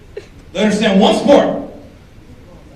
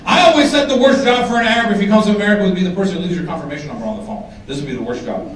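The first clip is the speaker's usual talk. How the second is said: usual speaking voice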